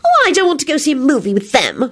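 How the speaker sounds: high, grumpy whine; petulant high-pitched grumble. A narrator's reading. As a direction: complain sulkily in a high voice. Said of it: "Solange said grumpily".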